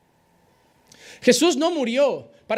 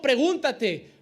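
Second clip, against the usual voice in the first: ordinary delivery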